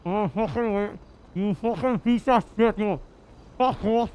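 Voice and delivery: put-on snarling Welsh accent, muffled; aggressive Welsh accent. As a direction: threaten him in a hard Welsh accent. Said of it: "aggressive Welsh".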